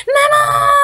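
high loud shout; boy yelling out for mum; child's shout. A narrator's reading. A boy surrounded by bears shouts for his mum. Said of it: "crie le petit garçon".

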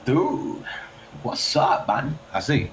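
half-hearted mock American accent; attempted American accent. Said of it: "your best American accent".

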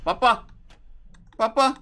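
put-on high, loud, frightened child's cries for dad; scared child calling. The boy calls his dad through the dark, high and frightened. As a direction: wail for daddy like a terrified kid.

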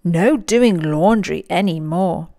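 usual speaking voice

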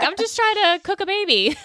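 high, put-upon mock voice; mock overwhelmed parent-to-be, pitched high. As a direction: play the frazzled parent-to-be with the pitch way up. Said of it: "you're like".

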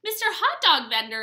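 loud, bright greeting; exclaimed. Acted. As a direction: call out to the vendor. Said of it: "Amu exclaimed".

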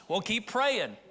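ordinary talk

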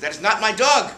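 high, loud protesting lady; hammed-up indignant lady; indignant old lady. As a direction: insist like an offended rich lady. Mrs Goldberg protesting.

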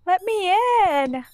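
desperate call to the door; pleading cry. Real, not acted. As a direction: beg to be let in.

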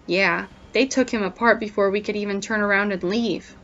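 ordinary delivery

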